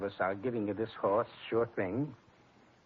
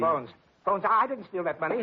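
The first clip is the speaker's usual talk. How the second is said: pleading; 6 semitones higher, 4 dB louder than usual. Acted. Joe pleading while roughed up.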